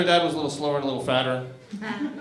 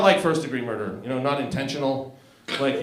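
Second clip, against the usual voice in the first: normal talking voice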